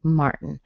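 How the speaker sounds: "Martin" with a held T then N, no vowel; glottal-style American Martin; nasal release. Shown as a demonstration. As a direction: keep the tongue up from T to N in "Martin". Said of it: "very common way to say Martin".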